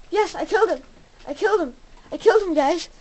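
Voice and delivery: loud, triumphant cheer; excited triumph. Real, not acted. Player tells viewers of a kill, excited.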